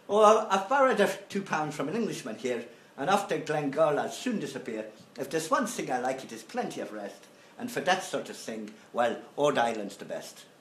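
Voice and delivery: Irish brogue, now leaning Scottish; Irish accent. Acted. Lecturer finishes reading the lyrics in an Irish accent. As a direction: keep the put-on Irish-Scots lilt going. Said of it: "mon accent irlandais a tendance à tourner écossais".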